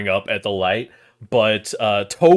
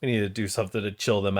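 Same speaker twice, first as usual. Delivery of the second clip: muttering annoyed dad; 2 semitones lower, 7 dB quieter, faster than usual. Acted. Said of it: "is like".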